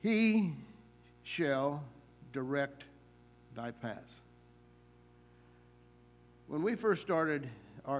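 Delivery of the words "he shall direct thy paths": each word of "He shall direct thy paths" set apart by long pauses; slow solemn quote. Shown as a demonstration. Preacher lingers over "He shall direct thy paths" for his young listener. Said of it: "promise that comes from the Lord himself".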